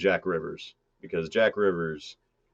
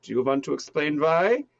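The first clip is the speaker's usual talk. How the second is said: higher, pointed German villain question; evil German; 8 semitones higher, 3 dB louder than usual. Acted. Boss demanding an explanation from his man, evil German accent. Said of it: "play the whole evil German thing".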